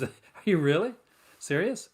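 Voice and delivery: real surprise with a laugh going in; can hardly believe the news; surprised. Real, not acted. Reacting to news of box set.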